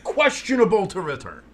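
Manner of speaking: slow high broadcast voice; mock sideline reporter. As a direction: report the injury like TV.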